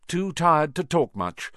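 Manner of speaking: plain reading voice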